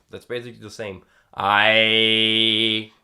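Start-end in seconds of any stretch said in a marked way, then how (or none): 1.3-2.9 loud held I; twanged I. Shown as a demonstration. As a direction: sing a long twanged I. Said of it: "but I have to combine them".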